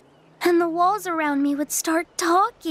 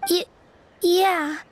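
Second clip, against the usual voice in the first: teary; 3 semitones higher, slower than usual. Acted. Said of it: "gets distracted easily by crying".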